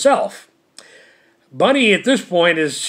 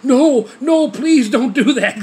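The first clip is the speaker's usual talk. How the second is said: much higher and louder, a laughing plea; mock pleading wife; 8 semitones higher, 4 dB louder than usual. Bunny begging Stan not to leave Earth, hammed-up high plea. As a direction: beg him to stay in a hammed-up high voice.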